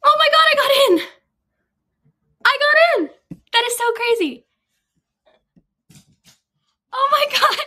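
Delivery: very high, loud and fast disbelief; ecstatic shout. Real, not acted. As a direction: shriek with real joy. Top choice acceptance.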